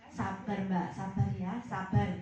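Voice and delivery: low, gentle "Sabar, Mbak, sabar ya"; soothing "sabar". Acted. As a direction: counselor calmly urging the upset client to be patient. Counselor's soothing advice acted out.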